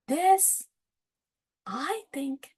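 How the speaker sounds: hushed, awed whisper; a whispered aside; whispered thought. Retold or imitated. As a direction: breathe it to yourself in wonder.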